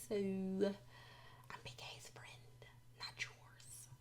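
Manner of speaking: whispered, drawn-out aside; unspoken subtext. Retold or imitated. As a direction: whisper what she really means, very slowly. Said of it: "what she's saying without saying it".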